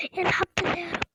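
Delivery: high, loud burst of relief; relieved cry of thanks. Real, not acted. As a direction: shout your thanks to God. Relieved loud thanks on finding Arabs.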